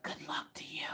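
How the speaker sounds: hushed dread; whispered good luck. Retold or imitated. Neighbor shutting her door on the tantrum, whispering.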